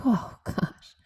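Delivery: soft whispered oh gosh; relieved whisper. Retold or imitated. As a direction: breathe it out in a whisper. After laughing, relieved.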